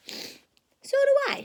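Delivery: put-on very high, slow, child voice; squeaky little sister; little Sister Bear. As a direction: chirp it like a small girl cub.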